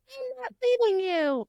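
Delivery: very high-pitched stubborn squeal; squeaky little creature refusing to budge; defiant little dragon. Retold or imitated. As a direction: put on a tiny high voice. Voicing defiant little Andarna, baby dragon.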